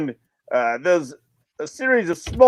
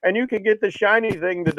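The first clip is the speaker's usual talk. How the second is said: wheedling plea; 9 semitones higher than usual. Retold or imitated. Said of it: "They're like".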